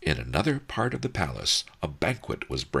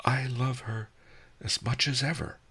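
sighing; 8 semitones higher than usual. Acted. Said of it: "I sighed".

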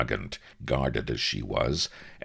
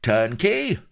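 high, loud summons; calling out; 14 semitones higher, 7 dB louder than usual. Acted. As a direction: call for the jailer. Calling for the jailer.

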